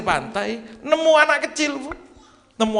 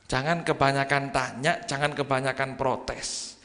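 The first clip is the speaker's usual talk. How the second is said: quiet stern warning; 6 semitones lower, 7 dB quieter than usual. Retold or imitated. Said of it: "kata Nabi Khidir".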